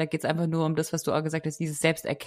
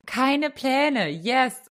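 excited echo; 8 semitones higher, much slower than usual. Real, not acted. Excited echo of the other speaker.

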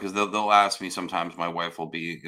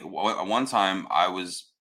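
ordinary delivery

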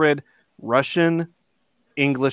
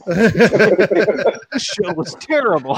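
slow, higher-pitched mock disgust; mock complaint; 5 semitones higher, 4 dB louder, much slower than usual. Unhappy listener reviewing the show, in slow mock complaint. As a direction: draw out a hammed-up bad review.

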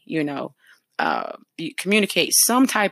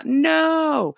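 emphatic no; 10 semitones higher than usual. Real, not acted. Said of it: "correcting wrong answer".

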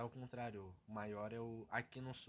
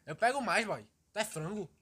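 higher, louder Bahian voice; Bahian accent; 7 semitones higher, 10 dB louder than usual. Pretend. Bahian accent, teasing the host loudly. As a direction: go high and loud in Bahian. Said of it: "imitar baiano".

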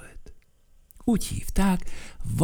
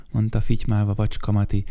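ordinary delivery, nothing marked

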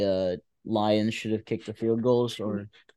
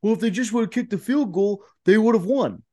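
high, whiny mocking imitation; mock the know-it-all fans; 10 semitones higher than usual. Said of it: "people are like".

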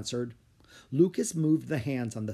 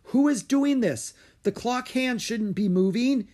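high, loud demand; alarmed yell; 10 semitones higher, 5 dB louder than usual. A narrator's reading. Lucas as the clock hands spin wildly, yelling. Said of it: "he yelled".

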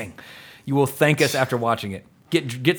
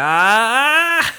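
excited outburst; 10 semitones higher, 6 dB louder than usual. Retold or imitated. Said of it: "I'm like".

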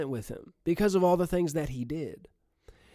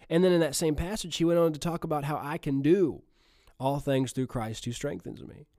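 usual speaking voice

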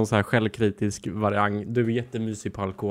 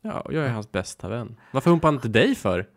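usual speaking voice

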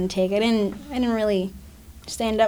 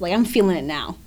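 ordinary talk

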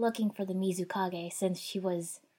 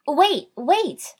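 high, loud cry to halt; loud, high-pitched urgent yell; urgent shout; 9 semitones higher, 11 dB louder than usual. A narrator's reading. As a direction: shout it to stop them. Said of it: "I shouted at them".

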